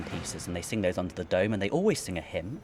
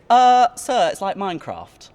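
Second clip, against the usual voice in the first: high-pitched pupil piping up, loud at first; put-on pupil voice, pitched well up; imitated schoolkid; 10 semitones higher, 9 dB louder than usual. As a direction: mimic a kid calling out to sir in class. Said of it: "one of the kids goes".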